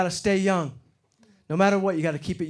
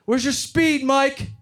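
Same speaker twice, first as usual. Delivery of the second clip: high, loud mock scolding; mock captain yell; 8 semitones higher, 4 dB louder, much slower than usual. Hockey captain yelling at a teammate, mock angry.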